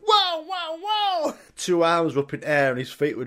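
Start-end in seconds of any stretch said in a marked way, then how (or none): 0.0-1.3 very high protesting whoas; dragged-off customer. Retold or imitated. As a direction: squeal whoa as your arms get grabbed. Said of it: "He was like".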